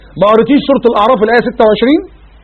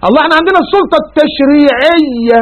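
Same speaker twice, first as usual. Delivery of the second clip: mock objector; 7 semitones higher, slower than usual. Voicing an objector.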